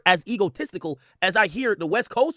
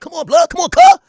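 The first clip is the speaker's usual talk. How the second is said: loud, high mock West Coast call ending in a shout; mocking West Coast slang; 12 semitones higher, 14 dB louder than usual. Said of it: "they're acting like".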